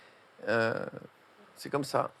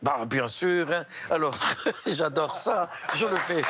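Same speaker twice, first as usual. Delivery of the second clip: drawn-out comic Belgian accent; slower than usual. Shown as a demonstration. Comedian obliging the interviewer's request, hammed-up Belgian accent. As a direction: put on a Belgian accent, laughing through it. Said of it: "Vous pouvez faire l'accent belge?".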